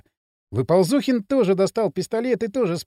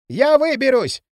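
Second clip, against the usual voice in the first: loud higher call; shout; 5 semitones higher, 4 dB louder than usual. A narrator's reading. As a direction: shout back under fire. Shouting under fire. (громкий крик)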